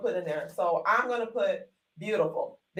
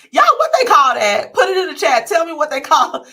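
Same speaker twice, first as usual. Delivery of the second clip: loud, higher call to the viewers; louder, higher appeal to the audience; calling out to chat; 5 semitones higher, 9 dB louder than usual. Real, not acted. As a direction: raise your voice to reach the chat.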